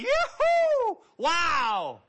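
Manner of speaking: very high, loud yoo-hoo; yelling. Shown as a demonstration. Healed leper trying a yell, very high and loud. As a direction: yell for joy. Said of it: "Try yelling".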